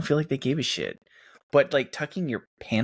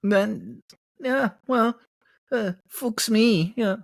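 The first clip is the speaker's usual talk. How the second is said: higher comic German slipping into Canadian; slightly Canadian accent; 5 semitones higher, slower than usual. Acted. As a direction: apologize with a Canadian-tinged put-on accent. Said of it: "That was a little Canadian".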